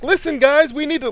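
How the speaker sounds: very high, mock mage voice; high-pitched mage. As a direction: put on a squeaky-high, feeble game mage. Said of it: "why are you so high pitched".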